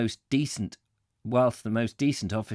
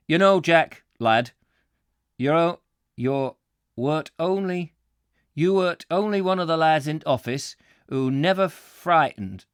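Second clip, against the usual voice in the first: higher, halting Yorkshire warehouseman; put-on Yorkshire accent; 4 semitones higher, 3 dB louder than usual. Warehouseman praises Jack for never being frightened, Yorkshire accent. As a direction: praise him in broad Yorkshire. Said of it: "this is very difficult to, uh, interpret".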